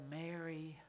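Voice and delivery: soft, hushed calling of her name; Jesus gently calling Mary by name, hushed and tender. Retold or imitated. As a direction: say her name gently, almost under the breath. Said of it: "He simply said".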